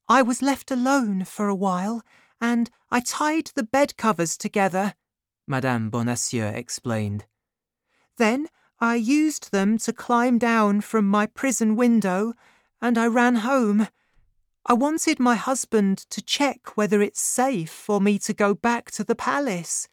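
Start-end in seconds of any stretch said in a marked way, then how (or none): 0.1-4.9 put-on high, light grateful young woman. A narrator's reading. 8.2-19.9 put-on high, light grateful young woman. A narrator's reading.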